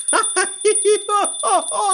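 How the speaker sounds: high, rolling laugh; fireman's laughter. A narrator's reading. Fireman tickled at the flamingos, high rolling laughter. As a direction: laugh helplessly. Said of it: "Said the fireman".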